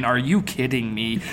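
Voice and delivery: ordinary talk, nothing marked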